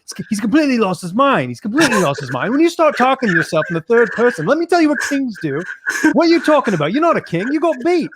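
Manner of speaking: a British promoter mocking a fighter, pitched high; hammed-up British accent impression of Eddie Hearn. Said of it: "do my U K accent here".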